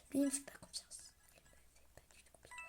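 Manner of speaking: secretive whisper, almost no voice; hushed distrustful whisper; suspicious whisper. Real, not acted. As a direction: whisper it like a suspect might hear. Suspicious aside in murder mystery.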